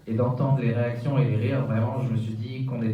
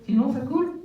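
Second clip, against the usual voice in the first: goofy muffled sock puppet; 13 semitones higher than usual. Acted. Puppet mishears the question.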